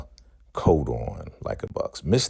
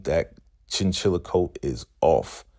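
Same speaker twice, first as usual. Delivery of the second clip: ordinary talk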